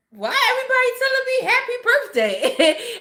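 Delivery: high-pitched, incredulous question; high voice on the edge of laughter; bewildered mock question. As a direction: squeak it out like baffled disbelief, amused. Voicing her own bewildered reaction.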